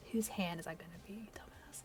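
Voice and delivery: sarcastic whispered aside. Real, not acted. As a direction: hiss it under your breath. Snarky aside to herself.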